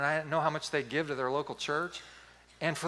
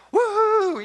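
high, loud celebratory whoop; drawn-out, high-pitched yell of joy; whooping cheer; 11 dB louder than usual. Retold or imitated. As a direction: let out a big re-enacted woohoo. Acts out his own celebrating cheer.

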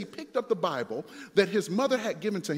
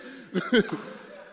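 short chuckle; 2 semitones higher than usual. Real, not acted. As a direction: chuckle at your own tangent. Laughs at own tangent.

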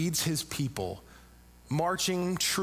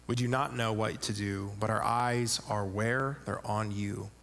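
impassioned Bible reading; dramatic scripture reading; 4 semitones lower than usual. Acted. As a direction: read it like a king pleading with God. Reading Jehoshaphat's prayer aloud.

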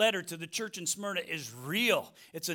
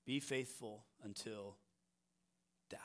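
hushed, grave scripture reading; solemn hush; 6 semitones lower, 11 dB quieter than usual. Real, not acted. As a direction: let the words sink in gravely.

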